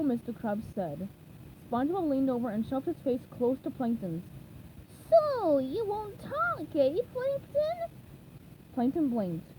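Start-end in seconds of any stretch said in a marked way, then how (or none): 5.1-7.9 much higher, slow, mock-menacing sponge voice; mock interrogation. A narrator's reading. SpongeBob grills Plankton, high mock-menacing drawl. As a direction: drag out a silly tough-guy threat up high.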